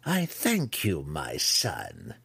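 gracious mother's thanks, sinking at the end; pleased matron voice, dropping low; beaming matriarch. Acted. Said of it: "Malice beamed at him".

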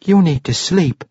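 put-on young boy voice. Boy urging drunk father.

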